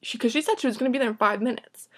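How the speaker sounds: usual speaking voice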